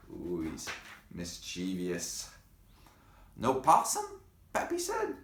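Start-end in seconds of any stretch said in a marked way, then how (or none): 3.3-5.2 high loud puzzled ask; surprised question. Acted. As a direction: ask in disbelief. Pappy voiced surprised.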